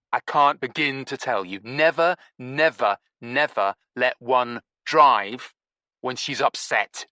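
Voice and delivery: Stinky lecturing the narrator, drawing out never let one drive; emphatic nevers. Acted.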